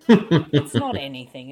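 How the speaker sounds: annoyed. Real, not acted. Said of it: "you're getting angry now".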